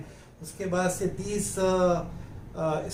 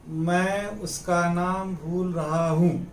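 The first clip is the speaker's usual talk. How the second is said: slow, evenly spaced dictation; slow example sentence. Shown as a demonstration. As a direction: say it slowly for learners, word by word. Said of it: "बातों बातों में आदमी कहता है ना". (धीरे-धीरे, साफ़ बोलकर)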